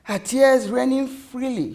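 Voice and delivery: usual speaking voice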